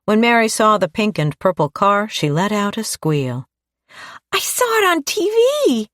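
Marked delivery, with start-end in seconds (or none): 4.3-5.9 put-on high, excited little girl's cry; excited shout. A narrator's reading. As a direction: shout it with a child's delight. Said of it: "shouted the little girl".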